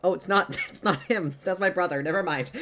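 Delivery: high, loud laughing correction. Real, not acted. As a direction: laugh it off, embarrassed. Laughing about phone texts.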